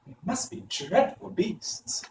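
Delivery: high, slow and soft; awed girl. A narrator's reading. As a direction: say it with a shiver of awe.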